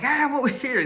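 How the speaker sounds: high, loud mock yell; calling out. Freezing man yells to searchers to give himself up, calling out. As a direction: call out to be found.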